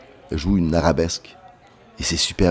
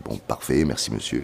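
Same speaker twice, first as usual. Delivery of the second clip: low, soft polite dismissal; retold diplomatic politeness; 9 semitones lower, 7 dB quieter than usual. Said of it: "j'essaie d'être diplomate". (politesse diplomatique rejouée, voix basse)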